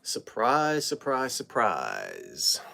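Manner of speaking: slow low surprise. Acted. Slow drawn-out surprise greeting.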